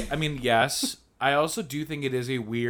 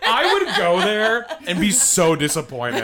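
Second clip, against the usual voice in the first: laughing; 6 semitones higher, 5 dB louder, slower than usual. Real, not acted. Joking about the laser institute.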